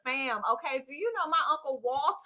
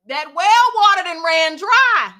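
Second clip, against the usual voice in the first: loud slow proclamation; dramatic declaration; 5 semitones higher, 14 dB louder, slower than usual. Acted. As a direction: declare it like doom.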